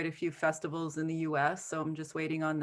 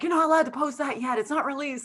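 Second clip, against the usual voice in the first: scolding son; 8 semitones higher than usual. Retold or imitated. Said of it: "He's like".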